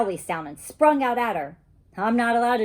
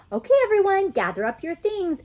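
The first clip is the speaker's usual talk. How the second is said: teacher calling out; 10 semitones higher, 4 dB louder than usual. Acted. Teacher addresses the class.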